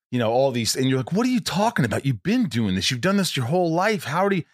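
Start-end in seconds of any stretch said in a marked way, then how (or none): 1.1-4.4 scolding yourself. Retold or imitated. Scolding his own fearful self.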